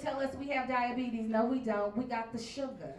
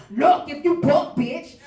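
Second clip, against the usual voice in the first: threatening shout; 5 semitones higher, 11 dB louder, slower than usual. Retold or imitated. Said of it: "I'm like".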